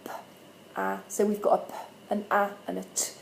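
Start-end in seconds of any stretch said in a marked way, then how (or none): 0.0-1.7 "p" and "ah" breathed, unvoiced; whispered sounds. Shown as a demonstration. Teacher blending with an unsure child, whispered. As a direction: whisper the sounds one at a time. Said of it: "we're gonna go".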